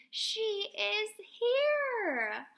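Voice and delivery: slow, high, near-tearful joy; joyful relief. A narrator's reading. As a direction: draw it out with relieved delight. Animals rescued by farmer.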